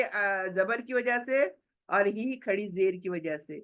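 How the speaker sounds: ordinary delivery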